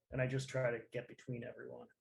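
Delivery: ordinary talk, nothing marked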